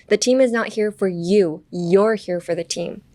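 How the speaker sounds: slow, weighted "you", then weighted "You're"; stress on "you" and "You're". Shown as a demonstration. Teacher explains the phrase, stressing "you" and "You're". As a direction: set "you" against "You're". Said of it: "youとyourを強調した方がもっと伝わりやすい".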